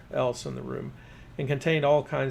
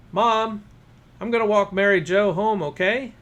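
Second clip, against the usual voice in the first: loud, higher call to the kitchen; yelled to mom; 7 semitones higher, 8 dB louder than usual. A narrator's reading. Boy tells his mom he'll walk Mary Jo home, a loud yell. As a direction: shout it through the door to mom. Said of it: "I yelled into the kitchen door".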